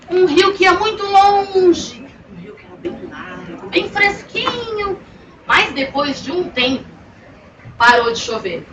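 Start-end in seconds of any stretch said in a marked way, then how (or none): 0.1-5.0 dreamy river. A narrator's reading.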